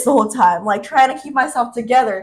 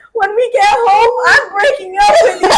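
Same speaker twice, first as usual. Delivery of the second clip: hammed-up tearful threat; 10 semitones higher, 8 dB louder than usual. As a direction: yell it like a melodramatic drunk. Acted breakup threat during drunken quarrel.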